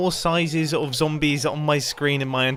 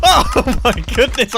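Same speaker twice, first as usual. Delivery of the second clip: laughing; 7 semitones higher, 7 dB louder, slower than usual. Real, not acted. Laughing at a memory.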